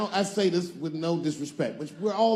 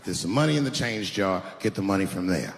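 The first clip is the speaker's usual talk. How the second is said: lower dad voice; 6 semitones lower than usual. Retold or imitated. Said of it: "Dad said".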